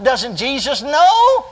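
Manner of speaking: high, drawn-out bewildered question; mildly distressed thought. Retold or imitated. Preacher retelling his racing thoughts in the vision, mildly distressed. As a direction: plead it upward, slowly.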